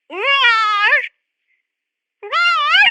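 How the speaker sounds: high-pitched mock roar; imitated animal roar. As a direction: roar to talk to the beast. Let me try talking to it.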